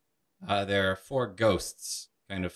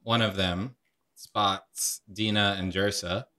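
usual speaking voice

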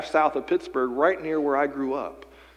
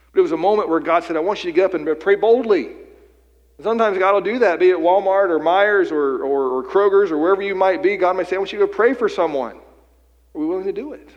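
higher and louder, pressing the call to bold prayer; preaching at full force; 6 semitones higher, 8 dB louder than usual. Acted. Pastor urging the congregation to pray boldly, preaching at full force. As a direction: lift it into a ringing sermon climax.

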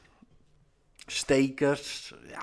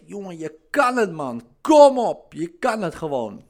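a cheering coach-like voice, louder on kom op; pep them up like a friendly voice in their head; encouraging Jesus voice; 4 semitones higher, 9 dB louder than usual. Retold or imitated. Jesus in your head encouraging you, a loud mock pep talk. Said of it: "Jezus in je hoofd zit die zegt".

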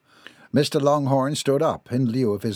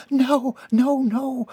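very high, panicked no-no-no; alarmed protest; 14 semitones higher, 3 dB louder, much slower than usual. A narrator's reading. Alarmed as pillows get thrown.

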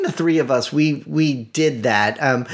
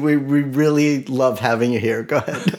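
ordinary delivery

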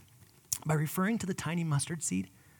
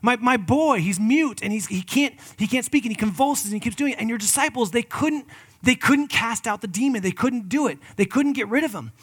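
a raised, urgent plea for his son; pleading father; 6 semitones higher, 7 dB louder than usual. Acted. Pastor voicing a father begging Jesus, urgent. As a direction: voice the desperate father, a bit louder. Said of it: "this father comes up and says".

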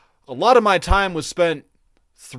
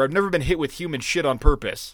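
normal talking voice

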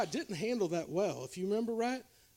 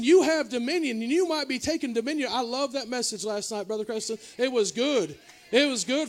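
usual speaking voice

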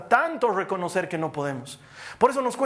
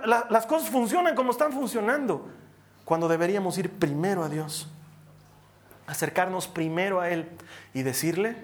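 ordinary delivery

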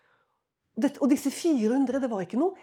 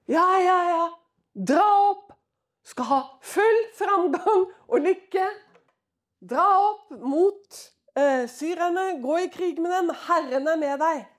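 loud, high, eager chorus of yes-men; hammed-up shouting of the flattering prophets, slowing on dra opp; mock cheering prophets; 6 semitones higher, 7 dB louder than usual. Preacher voicing the four hundred prophets urging Ahab, loud mock cheer.

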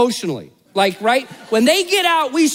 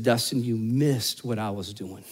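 God's voice; 13 semitones lower, 9 dB quieter than usual. Retold or imitated. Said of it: "And he said".

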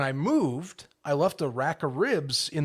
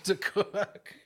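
laughing; 4 semitones higher, slower than usual. Real, not acted. Baffled, laughing at dad's ribs.

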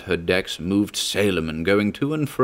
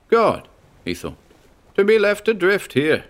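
tense officer; 13 semitones higher, 3 dB louder than usual. Acted.

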